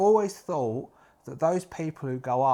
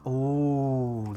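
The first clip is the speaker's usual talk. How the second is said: ordinary delivery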